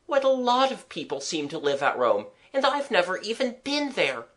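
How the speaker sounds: put-on mildly plaintive child, rising high; disconsolate. Child who has never been to Rome, mildly disconsolate. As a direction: lament with understated dismay. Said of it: "I cried disconsolately".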